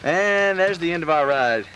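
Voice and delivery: loud, high cheering; cheering shout. Real, not acted. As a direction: whoop it up on the ride. Cheering on ride.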